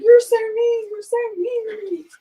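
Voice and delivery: high, loud whine of a hurt husband; mock whiny complaint. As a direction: whine it like a wounded big guy.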